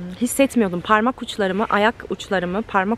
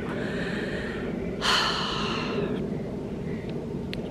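long sigh; 10 dB quieter than usual. Real, not acted. Long weary sigh after story.

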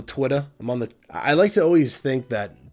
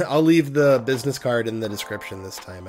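ordinary talk, nothing marked

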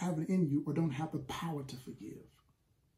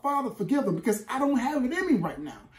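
raised, louder plea, higher pitched; yelling out to God; 4 semitones higher, 4 dB louder than usual. Acted. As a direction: cry out to the Father from the gut. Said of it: "you cry out, you yell out".

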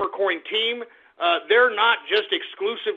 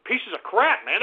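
ordinary talk, nothing marked

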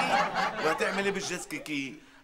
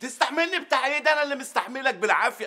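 outraged retort; 8 semitones higher than usual. Acted. Boss angry at worker.

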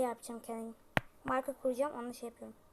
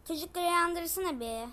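calling out; 4 semitones higher, 6 dB louder than usual. Acted. Calling to wake the kids.

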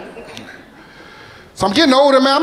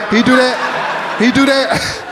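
laughing, drawn out; 4 semitones higher, much slower than usual. Real, not acted. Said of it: "she pointing at him".

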